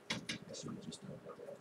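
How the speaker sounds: a quick regretful whispered aside. Real, not acted. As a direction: whisper it to yourself, half to no one. Muttered aside to himself.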